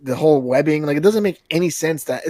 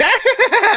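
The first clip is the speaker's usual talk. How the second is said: high manic mock cackle; put-on high, rapid villain laugh; Joker-like cackle; 4 dB louder than usual. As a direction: cackle like a comic-book Joker. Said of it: "supposed to be more like the Joker".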